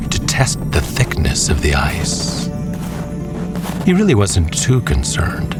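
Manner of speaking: usual speaking voice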